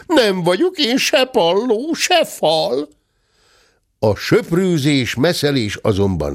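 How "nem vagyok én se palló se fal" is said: high-pitched indignant howl; high, wailing cub voice; bawling cub. A narrator's reading. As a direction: howl the protest high as a little bear. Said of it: "bömbölt Zebi".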